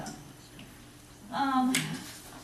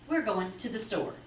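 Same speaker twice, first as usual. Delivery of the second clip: "We're going to the store" flowing, "to the" tied together; natural fluent phrasing; 2 semitones higher than usual. Shown as a demonstration. Teacher showing parents good phrasing, smoothly tied together. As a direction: run the words together in phrases. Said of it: "To the is kinda tied together".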